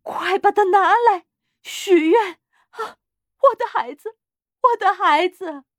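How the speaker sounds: high, loud, frantic pleading; overwrought, breathless wail; panting urgency. A narrator's reading. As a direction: gasp between desperate cries. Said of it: "他气喘吁吁地说".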